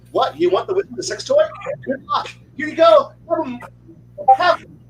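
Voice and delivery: high-pitched, hurried-to-please retold handover; mock eager offer. Player offers up the wizard to escape, mock eager.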